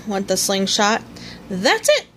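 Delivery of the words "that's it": high excited exclamation; high happy shout; excited cry. A narrator's reading. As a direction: burst out with the discovery. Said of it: "Tigger cried".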